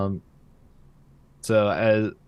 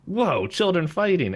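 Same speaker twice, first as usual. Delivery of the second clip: high, swooping exclamation of surprise; mock amazement; 9 semitones higher than usual. Stan spotting children fighting, mock amazement. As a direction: imitate an onlooker exclaiming at a sudden sight. Acted delighted onlooker in recap.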